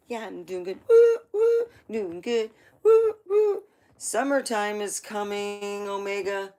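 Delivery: high, loud whooping self-cheer with cries of woo; mock cheering. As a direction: hype yourself up, whooping like a proud dieter. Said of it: "I would be like".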